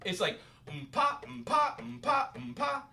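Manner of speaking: quick repeated mm-pa, rising; double-feel mm-pa. Shown as a demonstration. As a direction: mouth a steady doubled pulse.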